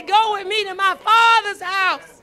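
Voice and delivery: loud quoted hymn line. Acted. Preacher quoting an old hymn to the church, loud. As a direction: call the old song out to the church. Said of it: "The old song say".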